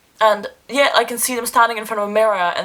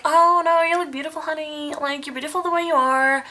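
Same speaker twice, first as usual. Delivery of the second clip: put-on higher, light reassuring girl voice; imitating sweet girlfriends; 7 semitones higher than usual. Mimicking girls comforting a friend about her looks, higher sweet voice. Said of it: "girls are always gonna be like".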